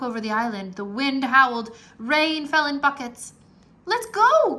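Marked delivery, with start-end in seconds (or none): 3.9-4.6 high, loud urgent call; girl urges her brother to flee; urgent cry. A narrator's reading. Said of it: "cried Annie".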